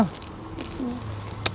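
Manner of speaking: barely voiced, choked slow murmur through tears; tearful. To her friend at his wedding news, a choked tearful murmur. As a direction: hold back the tears so only breath gets out. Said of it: "तू रो रही है?".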